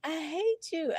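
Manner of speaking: high, slow quoted voice; a lightly voiced stranger saying it; heard voice. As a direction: echo the voice she heard, drawn out. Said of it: "I just heard somebody say".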